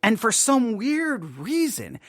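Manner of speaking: usual speaking voice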